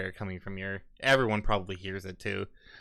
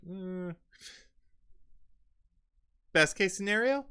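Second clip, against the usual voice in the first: much higher, mocking query; high sarcastic question; 10 semitones higher than usual. Guy mocking the robber's plan, high sarcastic question. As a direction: tease him with a high sneer.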